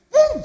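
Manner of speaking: sudden high, loud "pum"; imitated plunge. Peter throwing himself into the sea, explosive sound effect.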